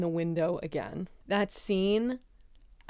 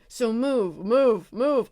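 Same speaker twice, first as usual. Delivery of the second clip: louder, repeated urgent commands; imitated impatient shooing; retold urgent ordering; 3 semitones higher, 5 dB louder, much slower than usual. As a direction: push each word out to hurry someone along. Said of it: "He was like".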